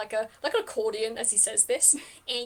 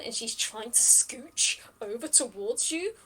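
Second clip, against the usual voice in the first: ordinary talk, nothing marked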